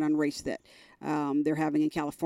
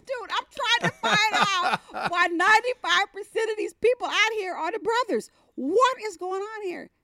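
exasperated; 3 dB louder than usual. Retold or imitated. Retells exasperation at her brother.

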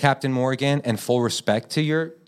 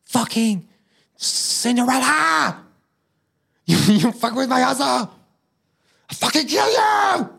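high, loud hammed-up shouting rage; raging Walt Disney; 13 semitones higher, 4 dB louder than usual. Imagined Walt Disney screaming at Cinderella, hammed-up rage. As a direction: scream threats like an unhinged mogul. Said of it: "Walt Disney or something, he's like".

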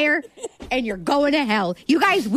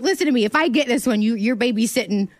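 usual speaking voice

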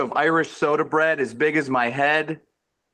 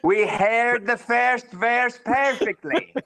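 slow, higher West Dublin accent with "airs" for "ers"; exaggerated Irish brogue, drawn out and pitched up; 11 semitones higher, slower than usual. Shown as a demonstration. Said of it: "take all the ers and change them to airs".

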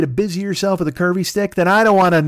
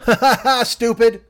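high mocking cackle into an insult; acted jeering, pitched up; taunting laugh; 8 semitones higher, 4 dB louder than usual. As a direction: laugh in their faces. [name] mocks his family.

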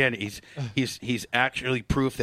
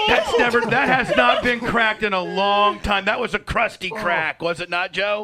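loud, high-pitched amazement; excited exclaiming; 7 semitones higher, 5 dB louder than usual. Real, not acted. Host marvelling to the doctor at a crack, excited.

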